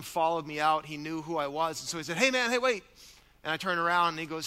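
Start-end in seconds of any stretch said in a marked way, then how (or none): 2.2-2.8 calling out. Retold or imitated. Stranger calling after pastor.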